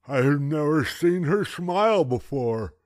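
put-on high, loud gummy old man; toothless grandpa. A narrator's reading. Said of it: "he explained".

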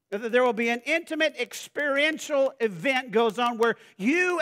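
loud, high, ringing preacher's voice; building sermon delivery, big and high; full-force preaching. Acted. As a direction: preach it out to the congregation. Preaching on intimacy with God.

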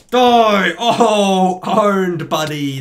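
loud triumphant yell; battle yell. Real, not acted. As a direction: roar the kill. Shouting as he kills Zach.

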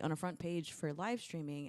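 normal talking voice

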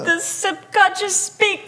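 high, slow mock-solemn laugh. Real, not acted. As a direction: intone it like an oracle, laughing.

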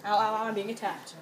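loud fast panicked yelp; raging gamer. Real, not acted. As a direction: cry out while under attack. Attacked in game.

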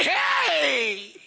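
long, high holler; booming shout. Acted. Preacher showing how God doesn't speak, hollering. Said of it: "But God doesn't say".

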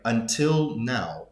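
"until now" drawn out very slowly; slow, deliberate stress on "until now"; emphatic. Shown as a demonstration. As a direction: stretch "until" with weight, then land on "now". Stresses literal sense of emphatic phrase.

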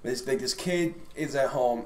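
ordinary talk, nothing marked

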